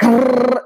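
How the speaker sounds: high trilled "grrr"; excited brain going "grrr"; craving brain noise. Retold or imitated. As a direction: trill a high, revved-up growl.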